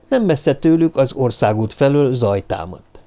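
ordinary delivery, nothing marked

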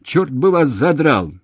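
loud, high alarmed swearing; frightened shout. A narrator's reading. Man cursing the exchange as the line drops, frightened shout. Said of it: "страшно испугавшись, закричал Устименко".

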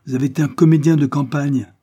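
angry outburst. A narrator's reading. Afi hurling an insult at Ebenezer, angry outburst. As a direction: throw the insult out hotly. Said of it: "éclata Afi".

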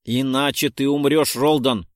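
loud, insistent warning; urgent shout. Acted. Narrator shouts urgently at Roldon. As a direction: shout the warning over him.

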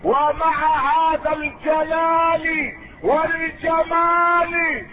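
high, slow, drawn-out sermon cry; impassioned pulpit oratory; preaching at full force. Real, not acted. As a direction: ring out each quality, stretching the endings.

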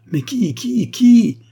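high, climbing cries, loudest on the last; urgent cry. A narrator's reading. Marquise begs her mother for the name, crying out urgently. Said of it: "s'écria la marquise".

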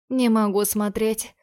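ordinary delivery, nothing marked

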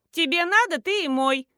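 high, loud cheeky retort; snapping back. A narrator's reading. Nadezhda answers mother-in-law back, snapping. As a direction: snap back and refuse the chore. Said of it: "огрызнулась Надежда".

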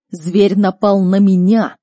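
offended, emphatic anger; angry father. Acted. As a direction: accuse him with rising outrage. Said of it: "Родитель гневно сузил глаза".

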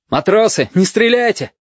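high cry to stop; urgent cry. A narrator's reading. Officer stops the firing squad, crying out. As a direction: call out high to halt them. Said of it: "крикнул Бенецкий".

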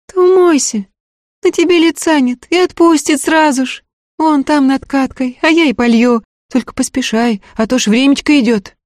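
put-on high girl's voice, friendly and confiding. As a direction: advise him kindly like a young maid. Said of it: "доверительно посоветовала".

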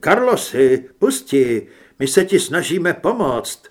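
loud, insistent appeal; urgent plea. A narrator's reading. Doctor urges possessed patient to let go, loud and urgent. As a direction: call out urgently to get through to him.

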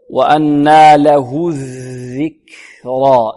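very slow recitation, puff of breath on the ك of الذكرى; breathed kaf. Shown as a demonstration. Teacher reciting for students, slow with airy ك in الذكرى. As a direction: stretch وأنى له الذكرى, release the kaf with air. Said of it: "الكاف مهموسة".

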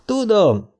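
high-pitched cry, falling at the end; yelling. A narrator's reading. Dick insists to doubting Trev, yelling. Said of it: "üvöltötte Dick".

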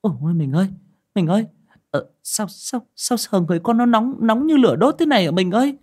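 high, loud frightened stammer; mother crying to her husband that their son burns; stammering in panic. Acted. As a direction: stumble over the words in terror. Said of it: "bà lắp bắp".

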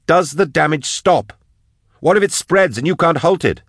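high, urgent, angry tirade; yelling. A narrator's reading. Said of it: "Drusha yelled at the cage".

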